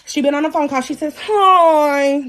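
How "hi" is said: mom's greeting. Retold or imitated. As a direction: imitate mom's long drawn-out hi. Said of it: "That's how my mom talk".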